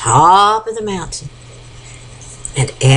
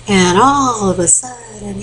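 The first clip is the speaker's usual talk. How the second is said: ordinary delivery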